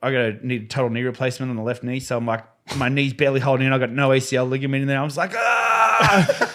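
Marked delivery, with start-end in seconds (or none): 5.3-6.5 high, hammed-up yell of strain; mock scream. Retelling the knee pain on the wave, hammed-up high yell. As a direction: let out a hammed-up high cry.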